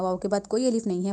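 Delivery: normal talking voice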